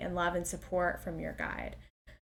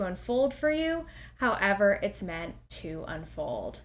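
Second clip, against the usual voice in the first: slow, soothing meditation guide voice over music; guided meditation; slower than usual. Acted. Guiding the listener up to meet a spirit guide, slow and soothing. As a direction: count the breaths calmly and draw each word out.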